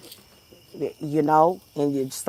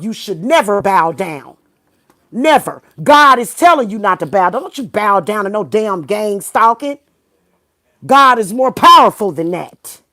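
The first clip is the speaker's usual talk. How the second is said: loud, higher preaching; rally them like a preacher; fiery exhortation; 4 semitones higher, 8 dB louder than usual. Real, not acted. Vlogger urging stalking victims, loud fiery preaching.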